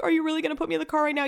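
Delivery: mock-panicked girlfriend, words tumbling; imitated panic. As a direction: rush it out in rising disbelief. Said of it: "she's panicking".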